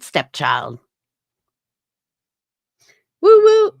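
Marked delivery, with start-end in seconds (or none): 3.2-3.7 loud, high-pitched woo woo; high whoop. Real, not acted. After asking viewers to smash the like button, high whoop.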